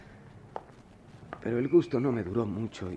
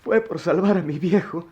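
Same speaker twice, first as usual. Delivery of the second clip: pained confession; 3 semitones higher, 5 dB louder than usual. Acted. Boy confesses theft to teacher.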